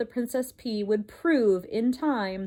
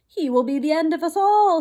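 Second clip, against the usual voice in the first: loud, higher despairing shout; 6 semitones higher, 7 dB louder than usual. Acted. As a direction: shout it as doom. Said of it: "he shouted".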